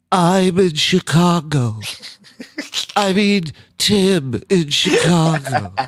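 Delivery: mock the host reading the caller's name wrong; imitating the host. Retelling how the host misread the caller, slow and high. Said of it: "and she goes".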